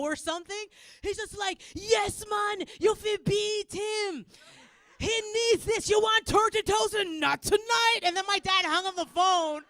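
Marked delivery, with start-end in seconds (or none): 1.7-8.0 shouted Jamaican dad impression; Jamaican accent. Dad on the phone to the captors, Jamaican-accented shout. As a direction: cheer them on loudly in a Jamaican accent. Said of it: "This is Jamaican accent".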